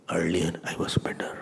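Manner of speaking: a soft breathy whisper to oneself, wistful; regretful whisper. Retold or imitated. The troubled lover quietly regretting the relationship, whispered.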